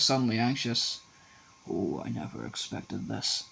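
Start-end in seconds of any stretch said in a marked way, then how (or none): 1.7-3.4 much softer, slow and worried; low worried voice. A narrator's reading. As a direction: murmur it, anxious and low. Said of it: "in a low, worried voice".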